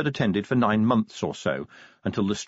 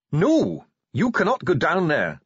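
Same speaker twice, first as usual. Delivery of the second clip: raised, higher-pitched dwarf voice; Ardent, angry refusal; 10 semitones higher, 4 dB louder than usual. A narrator's reading. Dwarf refuses to unlock the doors, angrily. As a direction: forbid it forcefully, loud from the first word. Dwarf flatly forbids Vimes entry.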